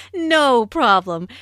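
loud, drawn-out "No"; slow, emphatic "No" after a laugh; lengthened NO. Acted. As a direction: stretch "No" with amused understanding. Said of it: "emphasis on no by lengthening".

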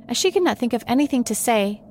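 ordinary delivery

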